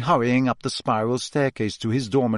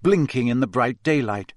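ordinary delivery, nothing marked

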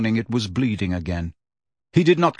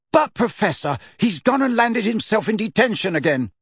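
indignant protest; 9 semitones higher than usual. Acted. Angelina protests loudly.

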